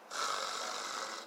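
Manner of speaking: soft, drawn-out dry gargle; gargled French R. Shown as a demonstration. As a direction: rasp quietly at the back of the throat. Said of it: "It's all in your throat".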